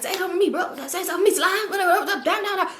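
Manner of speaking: high mock rambling into nonsense syllables; fussing homeboy. Homeboy challenging her after the sniff, high babbling imitation. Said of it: "He was just talking too fast".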